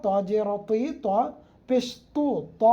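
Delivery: ordinary talk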